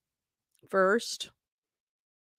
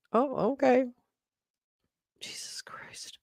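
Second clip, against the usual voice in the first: ordinary talk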